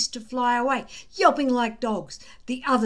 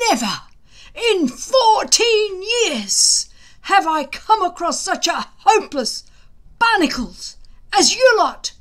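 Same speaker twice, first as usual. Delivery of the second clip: exploding at them; 9 semitones higher, 9 dB louder than usual. A narrator's reading. Gobber tearing into the shivering boys, furious bellow. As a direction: roar at the shivering boys, high and loud. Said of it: "he exploded all over the place".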